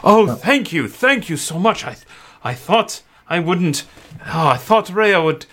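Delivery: father thanking the hero Indigo; grateful father. Acted. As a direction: gush thanks, almost overcome. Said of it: "and he says".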